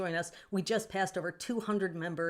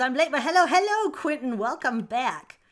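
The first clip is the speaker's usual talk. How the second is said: excited; 7 semitones higher, 10 dB louder than usual. Real, not acted. Reading and answering chat.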